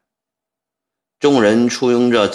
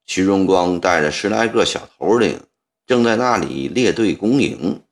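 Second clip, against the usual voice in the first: usual speaking voice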